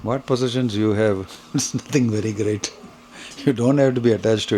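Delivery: usual speaking voice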